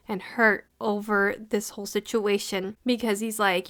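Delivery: ordinary delivery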